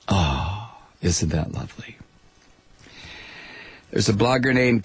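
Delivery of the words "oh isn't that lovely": sarcastic. Real, not acted. Sarcastic aside after mocking claims.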